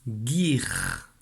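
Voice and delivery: Guir said slowly and clearly, hard g; dictation syllable. Shown as a demonstration. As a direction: repeat Guir clearly to the pupil, slow and clear.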